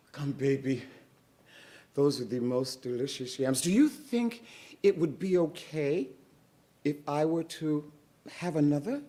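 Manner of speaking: high, sugary coaxing voice; butter up the gum baby for yams; sweet-talking Moesha. Acted. Moesha coaxing the gum baby, sweetly. Moesha asks for another yam.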